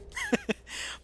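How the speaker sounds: real soft, high chuckle; amused chuckle. Real, not acted. Finding a viewer's picture a little disturbing, chuckling. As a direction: chuckle briefly and softly.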